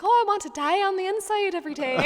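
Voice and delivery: put-on fast cheerful Irish accent; chirpy Irish lilt, sped up. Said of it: "spoke in an Irish accent".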